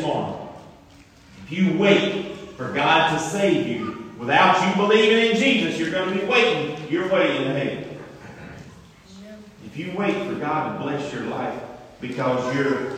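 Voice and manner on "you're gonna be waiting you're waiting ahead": usual speaking voice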